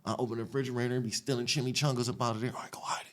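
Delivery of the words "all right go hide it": hushed whisper to lookouts; sneaky whispered order. Retold or imitated. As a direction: whisper it so nobody hears. Stealing food, whispering to friends.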